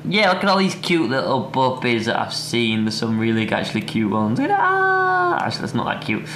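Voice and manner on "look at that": high, drawn-out coo; cooing squeal. Real, not acted. Vlogger browses beagle puppies, squealing over one. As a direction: squeal slowly over something adorable.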